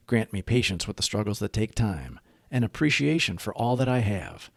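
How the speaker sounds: quiet earnest prayer. Acted. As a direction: recite it as a calm shared prayer. Leads the Serenity Prayer.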